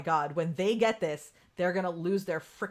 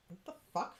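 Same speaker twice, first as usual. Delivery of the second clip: startled under breath; 2 semitones lower, 10 dB quieter than usual. Real, not acted. Finds something in her hair.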